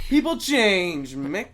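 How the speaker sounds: high, loud, slow mock drama; dramatic mock delivery. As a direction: stretch it out like a melodrama. Repeats quote in dramatic voice.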